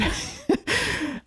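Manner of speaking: sudden squeaky laugh; high breathy laugh; high burst of laughter. Real, not acted. Laugh mid-story.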